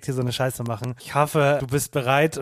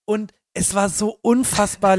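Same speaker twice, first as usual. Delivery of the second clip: ordinary talk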